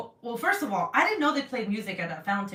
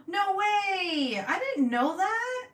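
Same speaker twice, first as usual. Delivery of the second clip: amazed; 11 semitones higher, much slower than usual. Real, not acted. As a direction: gush over the surprise. Reading surprising comment.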